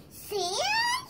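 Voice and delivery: high crying wail on sand. Acted. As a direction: wail the word like a sobbing bunny. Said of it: "she said".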